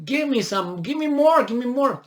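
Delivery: high-pitched mock demand; insistent begging. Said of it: "he keeps saying".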